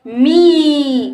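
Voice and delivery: मी pushed out with a held ee; long ee. Shown as a demonstration. Teacher reads मा plus matra for pupils, vowel held. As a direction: stretch मी long and strong. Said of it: "तो इसको बोलेंगे".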